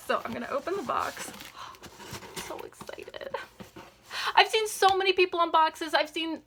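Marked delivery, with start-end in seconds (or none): 1.3-2.3 sharp breathless gasp of delight; soft unvoiced gasp as the box opens; whispered gasp. Real, not acted. Opening the long-awaited box. 2.4-3.4 slow, breathy whisper of excitement; hushed thrill at the box; excited whisper. Real, not acted. Opening the long-awaited box.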